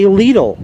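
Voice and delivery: "Lidl" said as LEE-dul; "Lidl" rhyming with "needle"; long-ee variant. Shown as a demonstration. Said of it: "Some people say".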